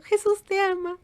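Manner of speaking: put-on very high, tender toddler voice; be a small child telling mom not to cry; little girl voice. Toddler hugging her mother, very high and tender.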